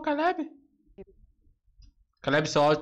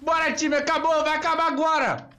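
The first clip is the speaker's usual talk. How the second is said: excited shouting; 9 semitones higher than usual. Real, not acted. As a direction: yell it out, the match is won. Celebrating the match win.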